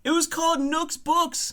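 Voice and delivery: high-pitched, protesting comic voice; indignant accused bookkeeper. Acted. Murder suspect insisting on an innocent job, high indignant protest.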